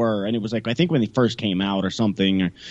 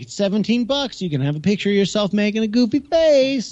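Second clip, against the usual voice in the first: high, bright sales pitch of a photo seller; mock salesman; 11 semitones higher than usual. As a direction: oversell it like a pushy vendor.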